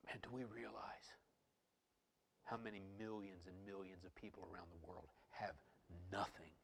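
very soft, breath-heavy reflection; hushed sober preaching. Real, not acted. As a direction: let it sink in quietly, pausing before the last word.

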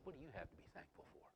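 quick whispered taunt in the ear; devil's whisper. Acted. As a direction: whisper it fast, as the tempting devil. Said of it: "He whispers in our ear and says".

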